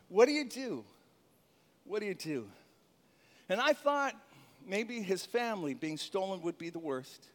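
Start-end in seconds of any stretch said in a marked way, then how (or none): 1.8-2.5 same question dropped much softer and lower; hushed, pondering repeat; quiet repeat. Shown as a demonstration. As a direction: let it fall away softly.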